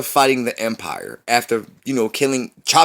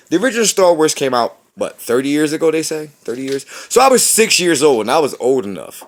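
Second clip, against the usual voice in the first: louder, fast and worked up; heated rant; 6 semitones higher, 7 dB louder than usual. Real, not acted. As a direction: press it like a fed-up fan. Heated rant about his age.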